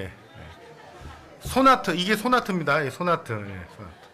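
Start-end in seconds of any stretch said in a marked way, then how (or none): 1.4-3.3 high, loud laughing call; laughing joke. Real, not acted. Pastor jokes with the congregation, laughing loudly. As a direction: burst out with the joke name. Joking gesture name, laughter.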